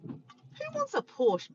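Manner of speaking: slow drawn-out scoff; playful mock disdain; mock snobbery. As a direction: tease like it's beneath you. Mock dismissive joke.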